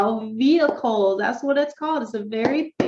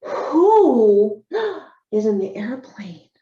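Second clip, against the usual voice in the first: slow question with a hammed-up gasp; mock amazed question; 4 dB louder, much slower than usual. Shown as a demonstration. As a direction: draw the child in with a playful gasp. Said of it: "And then you could say".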